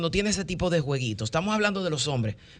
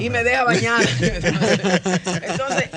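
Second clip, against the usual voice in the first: laughing; 4 semitones higher, 5 dB louder than usual. Real, not acted. Laughing at the case while still in role.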